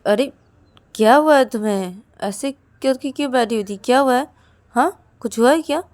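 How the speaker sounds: anxious friend asking what happened; concerned friend. Acted. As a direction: press her gently but urgently to say what's wrong. Ananya asks what's wrong.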